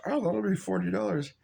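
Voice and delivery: mimicking the counter staff quoting a silly price; mock cashier voice. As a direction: sneer out the price like a fake-friendly clerk. Mocking fast-food cashier's price.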